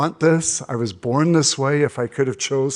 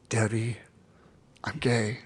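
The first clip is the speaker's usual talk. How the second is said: softer, hesitant confession; quiet confession; 3 semitones lower, 7 dB quieter than usual. Retold or imitated. Telling his coach the secret for the first time, quietly. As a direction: barely get the words out, held back.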